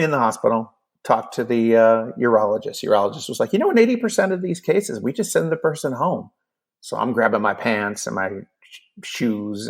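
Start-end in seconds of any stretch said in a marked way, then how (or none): 3.5-6.3 breezy doctor. Retold or imitated. Urologist's casual reassurance, retold.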